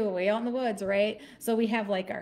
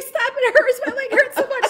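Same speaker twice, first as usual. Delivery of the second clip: high, loud mock whine of pain; imitated hurting mom; pained pleading; 12 semitones higher, 7 dB louder than usual. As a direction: wail about the pain, hammed up.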